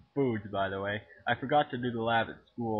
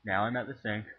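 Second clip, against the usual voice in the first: ordinary talk, nothing marked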